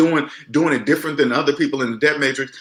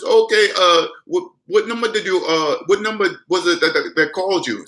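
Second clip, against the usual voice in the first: higher-pitched mock Indian accent, hesitant; imitated Indian accent; 5 semitones higher than usual. Scammer asking which number called, mock Indian accent. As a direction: imitate an Indian call-center worker. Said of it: "you hear that Indian voice".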